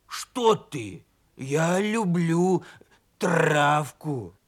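slow growly plea, rolled r; protesting wolfish voice. Acted. Donkey denying he is a wolf, slowly growling.

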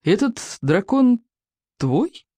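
slow, hesitant question; wary, drawn-out asking; hopeful doubt. A narrator's reading. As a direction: pause before the last word, hoping to be wrong. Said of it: "явно надеясь, что ошибся".

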